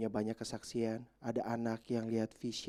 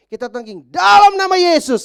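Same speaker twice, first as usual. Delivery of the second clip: rebuking shout; 25 dB louder than usual. Retold or imitated. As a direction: cry out the rebuke with full force. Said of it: "saya bilang gitu".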